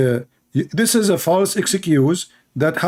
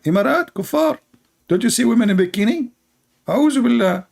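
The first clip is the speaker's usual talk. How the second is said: mock outrage; 8 semitones higher than usual. Mock outrage at bikini-wearing women.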